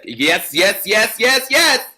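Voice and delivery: rising excited yeses for the crowd; building cheer. Real, not acted. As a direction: build it to a peak. Cheering a correct answer.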